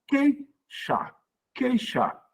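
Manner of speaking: "queixar" as QUEI, pause, XAR, pitched high; split into syllables. Shown as a demonstration. Teacher showing there is no hiatus, syllables split. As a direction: break "queixar" after QUEI, keeping EI together. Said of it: "isso aqui é tudo junto na mesma sílaba".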